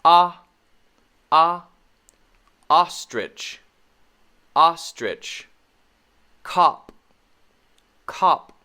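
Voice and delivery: "ah" loud and open, same vowel in "ostrich" and "cop"; short O demo. Shown as a demonstration. Teacher reviewing short O, emphatic separate "ah". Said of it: "And the O sound".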